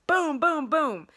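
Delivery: loud high boom boom boom; mimicking slams. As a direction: pound out each boom. Imitating door slamming.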